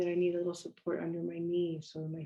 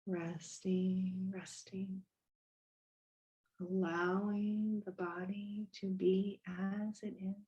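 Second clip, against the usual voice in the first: unhurried body-scan guidance with long pauses; guided meditation. Real, not acted. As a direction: gently lead them into stillness, letting phrases settle.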